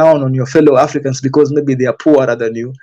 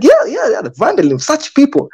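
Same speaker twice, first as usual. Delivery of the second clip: mock Mzungu voice; 9 semitones higher than usual. Mocking the Mzungu who eggs her on, in a high put-on voice. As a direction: mimic him going along, pitched up.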